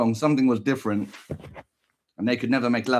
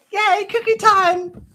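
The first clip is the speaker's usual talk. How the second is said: high, slow, loud cheer; childish glee; 5 dB louder, slower than usual. Pretend. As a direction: squeal like a little kid.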